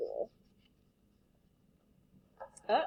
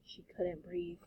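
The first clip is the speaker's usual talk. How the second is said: hushed narration; 3 semitones lower, 11 dB quieter, slower than usual. A narrator's reading. Tense story moment.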